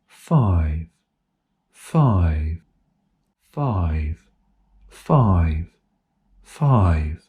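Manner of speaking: five held with long steady voicing; each five said with long smooth voicing; long phonation intervals. Shown as a demonstration. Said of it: "I will say a word with a long phonation interval".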